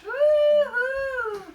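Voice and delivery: high, loud woo-hoo; cheer. Real, not acted. Cheering the news of the stickers, a loud whoop. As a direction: cheer wildly.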